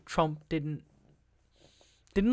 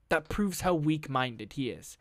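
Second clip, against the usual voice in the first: ordinary delivery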